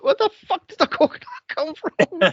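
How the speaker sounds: high, fast, laughing disbelief; baffled outburst. Retold or imitated. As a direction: laugh through the confusion. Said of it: "I'm like".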